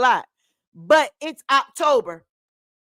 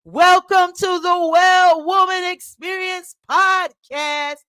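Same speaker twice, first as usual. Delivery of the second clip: announcer intro; 5 semitones higher, 6 dB louder than usual. Acted. Host announces show title.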